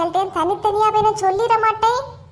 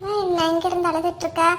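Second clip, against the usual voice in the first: ordinary talk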